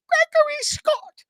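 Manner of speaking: very high, loud, shrill-pitched mother scolding; over-the-top high mom voice, half-shouted; nagging mom voice. Retold or imitated. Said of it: "it goes like this".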